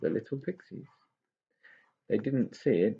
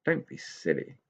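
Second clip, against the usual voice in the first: lower, softer, scoffing; dismissive; 4 semitones lower, 4 dB quieter than usual. Acted. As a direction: brush the idea aside. Tigger dismissive.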